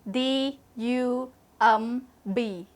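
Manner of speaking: slow letter-by-letter spelling. Shown as a demonstration. Teacher spelling a word for students, slow and distinct. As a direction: spell each letter slowly for the class. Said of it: "Dump spell".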